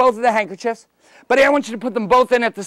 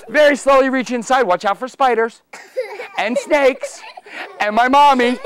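usual speaking voice

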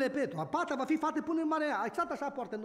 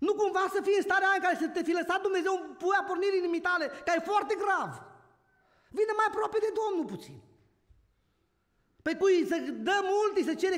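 preaching at full force; 7 semitones higher, 3 dB louder than usual. Real, not acted. Preacher exhorting with rising force.

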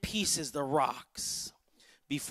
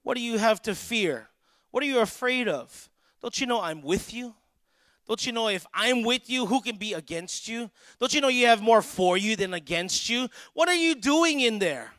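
raised pitch, building to a loud final question; insistent challenge; 4 semitones higher, 3 dB louder than usual. Acted. God challenges someone hiding in a cave, urgent and insistent. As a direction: press each question harder, rallying the fearful.

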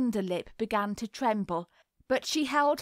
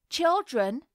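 put-on raised, higher call to the children; 6 semitones higher, 4 dB louder than usual. A narrator's reading. Hostess calls the children upstairs, raised calling voice. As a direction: call the children from across the room. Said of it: "called Missus Antrim".

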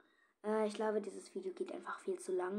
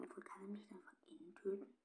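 quick hushed whisper; nervous whisper; 11 dB quieter, faster than usual. Real, not acted. Player hiding by the window, nervous whisper. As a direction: whisper your worry quickly.

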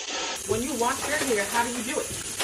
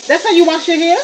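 incredulous; 6 semitones higher, 10 dB louder than usual. Real, not acted. Mom scolds rough hair washing.

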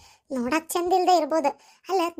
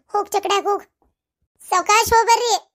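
raised, louder farewell call; 4 semitones higher, 3 dB louder than usual. Acted. Mother seeing off daughter's cart, calling out. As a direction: call it after the departing cart.

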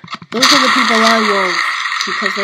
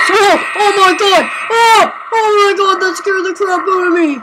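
startled scream; 7 semitones higher than usual. Real, not acted. Gamer startled by a scare, shrieking. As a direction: jump out of your skin.

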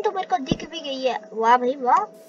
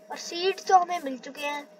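normal talking voice

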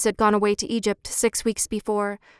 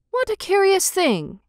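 high-pitched, mildly astonished exclamation; surprised cry; 9 semitones higher than usual. A narrator's reading. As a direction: puzzle aloud in a higher voice. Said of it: "he cried".

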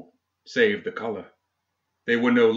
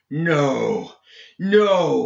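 shout; 5 semitones higher than usual. A narrator's reading. Seamus fighting the spirit inside him, shouting. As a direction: draw out each no, rising to a shout. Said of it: "he shouted".

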